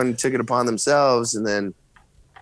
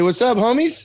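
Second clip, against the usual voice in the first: put-on say it for the kid; 10 semitones higher than usual. Shown as a demonstration.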